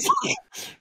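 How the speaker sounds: squealing high laugh. Real, not acted. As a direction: burst into laughter. Laughing hard.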